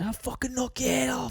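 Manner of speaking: high, strained mock yell held down in volume; retold yell, high and half-whispered; imitated angry yell. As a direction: mimic a furious threat in a squeezed high voice. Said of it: "he was yelling at me".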